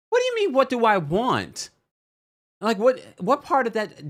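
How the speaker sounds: high, incredulous what do you mean; mock outrage at a dumb question; exasperated disbelief. Real, not acted. Host ranting at a cast member's question, exasperated.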